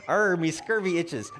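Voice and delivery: hammy pirate line; pirate growl, slow. Pretend. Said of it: "how about this".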